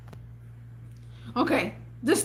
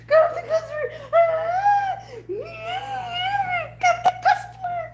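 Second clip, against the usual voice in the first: frantic boss imitation. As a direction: scream the order like a panicked boss. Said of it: "This is exactly how you sound".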